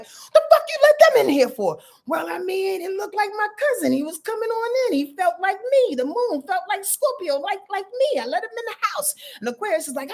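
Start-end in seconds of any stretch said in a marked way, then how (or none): 0.0-1.8 high, loud, outraged "The fuck you let them in here for?"; indignant shout. Acted. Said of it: "And Pluto was like". 2.1-9.1 high, innocent voice making excuses; sheepish excuse. Acted. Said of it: "Pluto was like".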